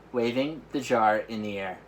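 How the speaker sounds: plain reading voice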